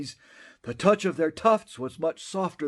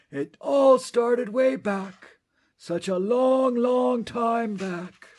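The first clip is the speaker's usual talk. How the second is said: slow, high and drawn out; drawn-out reminiscence; 9 semitones higher, 4 dB louder, slower than usual. Acted. Onceler remembering long ago, slow and dreamy. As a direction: stretch time back like a sigh.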